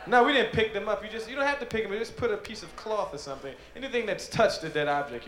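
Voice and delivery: normal talking voice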